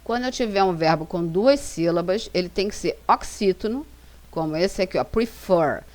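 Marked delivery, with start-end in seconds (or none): 5.0-5.8 prefer with the weight on fer; prefer, fer stressed and falling. Shown as a demonstration. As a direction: lean into the second syllable of prefer. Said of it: "Fer é a sílaba mais forte".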